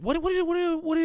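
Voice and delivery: rising confused what what; puzzled, voice climbing up; baffled. Real, not acted. Baffled at a knife listing.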